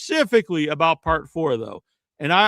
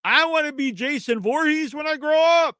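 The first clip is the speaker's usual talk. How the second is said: put-on higher, yelled boast of a kid; hammed-up childish cheer; mock kid shout; 6 semitones higher than usual. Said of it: "No one watched that and thought".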